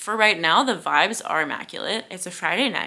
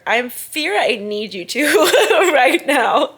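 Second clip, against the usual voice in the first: louder, higher, laughing mid-line; laughing plea; 6 semitones higher, 7 dB louder, slower than usual. Real, not acted. Urging viewers to read the book, laughing. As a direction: beg them through a laugh.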